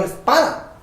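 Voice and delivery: lightly acted cry from an annoyed crowd; mildly angry outcry. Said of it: "a enojar y dijeron".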